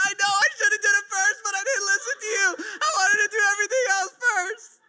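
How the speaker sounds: put-on high, sulky kid voice; whiny child. Dad voicing his son admitting he didn't listen, high whine. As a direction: whimper it like a caught-out kid.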